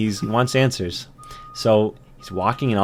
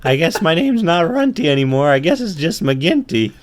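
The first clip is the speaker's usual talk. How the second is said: raised ant voice; half-grown ant, pitched up; teenage ant; 7 semitones higher, 4 dB louder than usual. Acted. Ant giving up his runty name, high teenage voice. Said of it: "I must be turning into a teenager".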